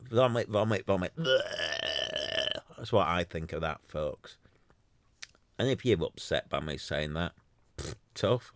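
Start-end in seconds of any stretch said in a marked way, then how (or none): 1.2-2.6 mock retching. Real, not acted. Reacting to fake flattery on Clubhouse, mock retching noises. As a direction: fake gagging blech sounds. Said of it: "It's vomit".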